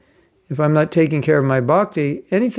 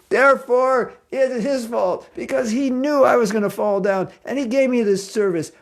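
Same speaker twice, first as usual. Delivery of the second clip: high-pitched, self-righteous whine of blame; mock indignant protest; 8 semitones higher than usual. Fallen devotee blaming Krishna for the service, mock indignant. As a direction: plead your innocence, hammed up and pitched high.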